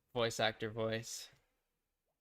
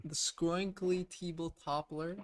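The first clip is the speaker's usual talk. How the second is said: silly drawn-out name read; 6 semitones higher, much slower than usual. Pretend. Reading silly item name.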